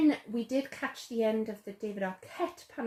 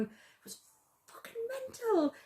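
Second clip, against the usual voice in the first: a loose stab at his London voice, hushed then high; imitating his accent. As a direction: quote him in a rough put-on accent. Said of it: "I can't do the accent".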